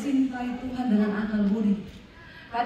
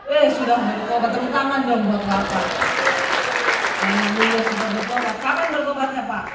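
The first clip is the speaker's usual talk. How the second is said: excited cheer; 8 dB louder than usual. Real, not acted. Cheering a repentant smoker.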